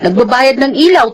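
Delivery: ordinary delivery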